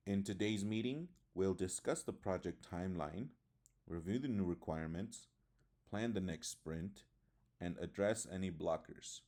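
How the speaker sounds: items lifted up to "sprint", falling on "blockers"; list intonation. Shown as a demonstration. Teacher reading a meeting agenda example, rising then falling intonation. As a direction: raise each item's end, then drop on "blockers". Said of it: "the pitch rises with each item except the last one".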